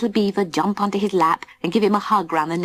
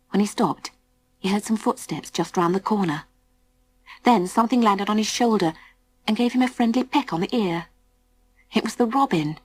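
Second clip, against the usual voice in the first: usual speaking voice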